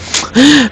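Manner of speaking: quick high-pitched giggle; high squeaky laugh. Real, not acted. Speaker laughs at own joke.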